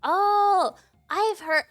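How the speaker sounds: usual speaking voice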